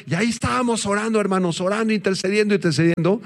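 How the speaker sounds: high-pitched, fervent recounting; fervent retelling. Retold or imitated. Pastor recalls interceding with brothers, fervently. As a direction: keep the urgency of the prayer while telling it. Reliving the urgent group prayer.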